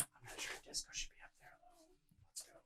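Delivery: soft secretive whisper. Acted. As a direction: lean in and whisper it. Whispering to party member.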